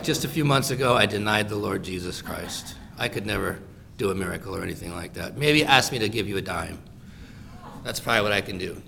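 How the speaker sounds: low, soft self-doubting excuse; mock dejected. Imagined Peter tells beggar he can't heal, acted dejected. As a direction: make a feeble, defeated excuse, low and soft.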